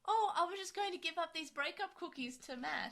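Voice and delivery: high, loud mock dub line; a jokey put-on voice for a sarcastic rewrite. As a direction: ham up a silly rewritten line.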